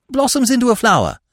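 rushed, high finish to the line; rapid. A narrator's reading. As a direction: rattle it off in a hurry. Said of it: "concluded Freddy rapidly".

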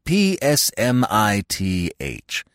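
slow, each letter set apart; P S M I T H spelt letter by letter, slowly; spelling it out. Shown as a demonstration. Psmith spelling his name out slowly for the maid. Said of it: "No, no".

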